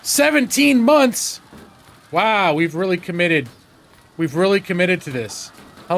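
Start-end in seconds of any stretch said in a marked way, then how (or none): 0.0-1.4 surprised. Real, not acted. Shocked at long build time.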